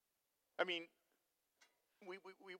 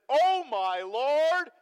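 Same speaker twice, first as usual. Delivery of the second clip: slow, higher and louder cry of alarm; alarmed servant; 5 semitones higher, 10 dB louder, much slower than usual. Retold or imitated. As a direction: stretch it out in panic. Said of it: "the Bible literally says, he says".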